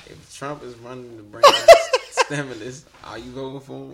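reading through a laugh; loud, giggling read. Real, not acted. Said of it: "Wait, read it again".

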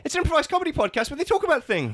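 high, fast frantic excuse; panicked pleading. Acted. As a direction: babble in a panic. Panicked suspect.

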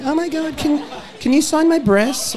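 high, slow, giggly fan gushing; mock female fan. Publican mimicking a gushing fan to the host, high and giggly. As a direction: squeal it like a starstruck girl asking for a signature.

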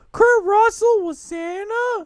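high, drawn-out, loud mock disbelief; shrieky slow question, putting on mom's surprise; incredulous mom. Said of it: "She's like".

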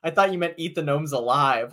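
normal talking voice

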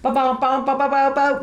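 high babbling imitation of garbled speech; imitating garbled noise. Shown as a demonstration. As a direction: babble it like the implant's raw noise. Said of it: "That's what it sounds like to me".

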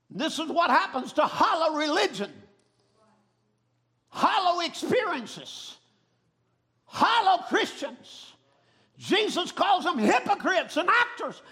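high, shouted revival preaching; full-force preaching. Real, not acted. Preacher warns the church to flee dead religion, shouted preaching. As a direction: drive each phrase out to the congregation.

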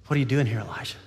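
lightly voiced God, dropping very low; still small voice. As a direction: let the question sink down gently at the end. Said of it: "God's in the still, small voice".

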